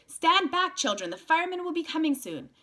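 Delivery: put-on loud, high call to the children; shouting to the kids. Man shouting at children to stand back, loud and high. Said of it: "Then to the kids he shouted".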